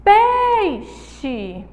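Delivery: "Peixe" with PEI held long and high; a teacher's exaggerated stress on the PEI of "Peixe"; stressed syllable drawn out. Shown as a demonstration. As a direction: call out "Peixe", stretching the first syllable. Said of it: "qual é a sílaba tônica".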